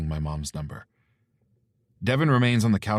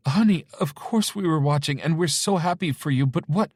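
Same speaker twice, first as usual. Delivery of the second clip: flustered mother; 10 semitones higher, 5 dB louder than usual. Acted.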